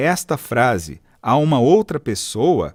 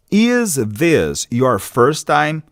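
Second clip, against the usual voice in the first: slow model phrase; much slower than usual. Shown as a demonstration. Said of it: "Essa é a sua primeira vez?".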